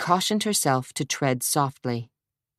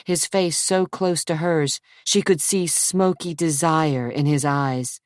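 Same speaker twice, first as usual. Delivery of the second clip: ordinary delivery, nothing marked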